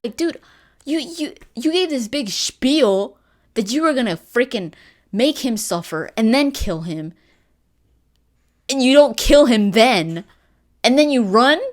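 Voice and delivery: high, loud exasperated disbelief; incredulous rant. Real, not acted. Vlogger ranting at Connor for letting him run, loud and incredulous. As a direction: call him out, baffled.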